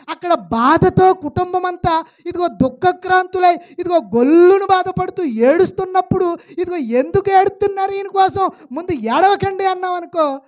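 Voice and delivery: loud, high preaching voice, pushing each phrase out; full-force preaching. Acted. Preacher booming at the congregation in full preaching force. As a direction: preach it hard to the pews.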